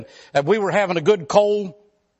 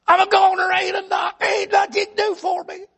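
high, tearful whine about pain; whimpering hypochondriac; 11 semitones higher than usual. Retold or imitated. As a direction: whine like every touch is agony. Arzy wailing despair.

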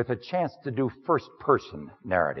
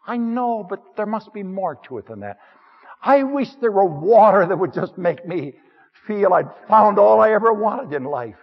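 higher and louder, yearning woman's voice; longing woman; 8 semitones higher, 6 dB louder than usual. Acted. Samaritan woman telling her man she longs for more, higher and yearning. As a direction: reach up with longing for something more.

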